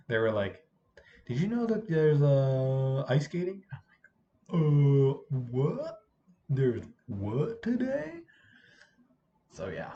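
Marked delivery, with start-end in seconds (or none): 1.3-3.6 put-on excited kid voice. Kids quoted asking. 4.5-8.2 slow, confused what; baffled disbelief. Retold or imitated. Speaker's baffled reaction.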